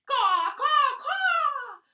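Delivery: very loud, high crow caws; cawing crows. Acted. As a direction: shriek like a crow. Flock mobs overhead.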